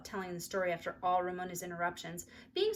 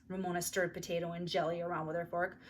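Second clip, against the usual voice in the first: ordinary delivery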